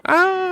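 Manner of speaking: short, high yelp of pain; hammed-up high cry "Ah!" as if hit; mock scream of pain. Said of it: "You screaming".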